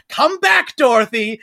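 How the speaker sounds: high, loud mock call after her; terrifying nurse calling. Terrifying nurse chasing Dorothy through the woods, loud high call. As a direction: chase her with the voice. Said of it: "the nurse, who is, uh, frankly terrifying".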